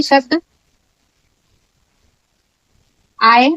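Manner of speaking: slow dictation with long gaps between words. Shown as a demonstration. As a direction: dictate it so students can write each word. Dictating rule word by word. (धीमे-धीमे रुक-रुक कर लिखवाना)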